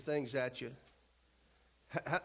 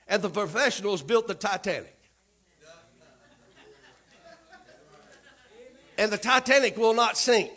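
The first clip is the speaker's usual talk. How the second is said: louder sermon build toward the punchline; preaching; 3 semitones higher, 8 dB louder than usual. Acted. Pastor mocking the Titanic to congregation, loud preaching. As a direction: drive the point home to the pews.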